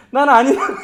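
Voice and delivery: mock loud protest; accented denial. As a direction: Peter protesting too much in his dialect. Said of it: "이렇게 얘기하는 게 이미 걸린 거야".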